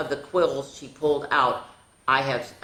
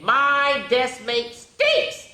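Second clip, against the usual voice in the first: slow dramatic read; 12 semitones higher, 5 dB louder, much slower than usual. A narrator's reading.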